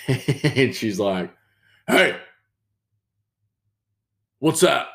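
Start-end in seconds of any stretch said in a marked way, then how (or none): 1.9-4.9 lightly voiced lady, higher pitched; high, punchy mock challenge; imitated nosy lady. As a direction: call it out high and loud, as her. Voices lady who comes up.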